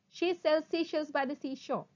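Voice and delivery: brisk, fluent run; sped-up tongue twister. Shown as a demonstration. Teacher shows the twister at speed, quickly. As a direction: say it in one quick sweep. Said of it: "speed up".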